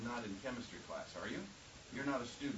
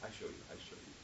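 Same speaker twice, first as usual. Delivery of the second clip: soft student voice; 7 dB quieter, faster than usual. Retold or imitated. Voicing Colombian student.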